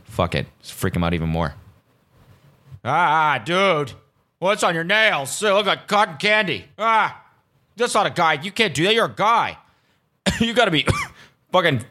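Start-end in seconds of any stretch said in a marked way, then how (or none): 2.8-9.6 mock scared bro. Mocking dudes again. 10.2-11.8 coughing through the words. Real, not acted. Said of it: "Fucking bronchitis or something".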